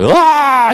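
sudden high, loud wailing cry; demo wail. Shown as a demonstration. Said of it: "weeping that came from the pit of your stomach, like".

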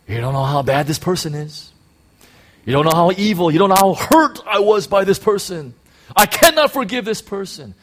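mock self-pitying prayer. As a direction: whine it to the Lord, rising to a pained cry. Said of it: "we're saying".

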